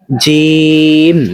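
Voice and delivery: এরপর রয়েছে জিম read very slowly, জিম held long; slow drawn-out teacher reading; very slow model reading. Shown as a demonstration. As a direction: spell it out slowly for beginners. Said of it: "উচ্চারণগুলো আমি যেরকম করতেছি".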